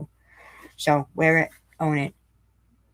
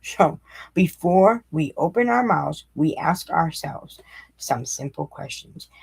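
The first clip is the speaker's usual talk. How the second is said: slow, deliberate reread; slower than usual. Shown as a demonstration. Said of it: "listen closely and, and really process this".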